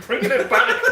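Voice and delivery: words breaking into a laugh. Real, not acted. Friend laughing while telling a grandfather story, laughing. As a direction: laugh through the punchline.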